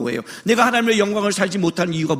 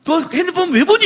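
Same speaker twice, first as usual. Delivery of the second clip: higher, sharp mother's question; mother scolding; 7 semitones higher than usual. Retold or imitated. Mother catching her child on the phone, higher. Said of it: "그러면".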